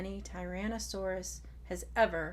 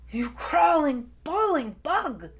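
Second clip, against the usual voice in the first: loud, higher scornful growl at the pest; 7 semitones higher, 8 dB louder than usual. Acted. Tai scolds his little sister, loud angry growl. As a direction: snarl the insult down at her. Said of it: "he growled".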